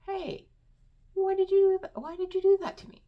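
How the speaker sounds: shrill wounded "why did you do that to me"; hurt high-pitched protest. Retold or imitated. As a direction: squeal out the hurt victim's protest, fast. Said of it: "And if you say".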